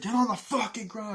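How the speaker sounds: high, loud mock bark; re-enacted aggressive command; acted soldier's order. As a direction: yell it like an angry soldier.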